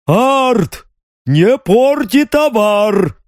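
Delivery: high, slow shouted rebuke; shouting. A narrator's reading. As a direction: yell a warning across the hall. Guard shouts at another.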